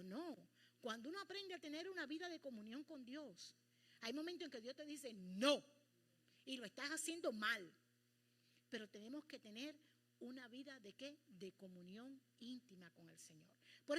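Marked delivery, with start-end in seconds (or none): 5.1-7.7 loud, blunt no; firm correction. Retold or imitated. God telling the believer they do it wrong, loud firm correction. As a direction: cut them off firmly.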